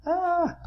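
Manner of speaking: high startled squawk; a sudden fright cry, very high; panicked squawk. A narrator's reading. Frightened duck squawk at Spike's grin.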